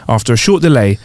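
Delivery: ordinary talk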